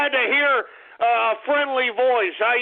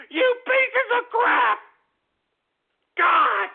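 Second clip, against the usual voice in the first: crying insult; 6 semitones higher, slower than usual. Acted. Host wails at trolls.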